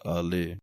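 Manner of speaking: ਆਲੇ said softly with a light l; ਆਲੇ ਪੋਲਾ; soft pronunciation. Shown as a demonstration. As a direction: let ਆਲੇ come out soft. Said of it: "ਇਹ ਪਾਠ ਪੋਲਾ ਪੜ੍ਹਨਾ ਹੈ".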